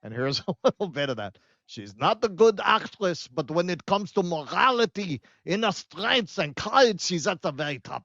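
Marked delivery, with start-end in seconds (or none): 1.7-8.0 put-on Israeli accent, lilting up and down; mock Israeli accent. Host reads out a subreddit comment, mock Israeli accent. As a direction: read it out in a hammed-up Israeli accent. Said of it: "Even the Israel subreddit agrees".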